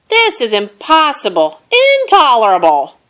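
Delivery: high, very loud, building outburst; outraged roar. A narrator's reading. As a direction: roar it out at the end of your patience. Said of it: "Bear started to roar".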